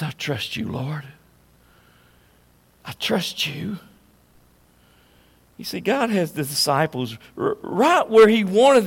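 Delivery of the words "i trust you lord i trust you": soft, hushed prayer of trust; quiet prayer. Retold or imitated. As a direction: pray it gently under your breath.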